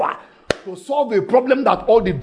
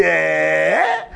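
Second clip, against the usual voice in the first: astonished eh; 4 semitones lower than usual. Acted. Woman's astonished reaction.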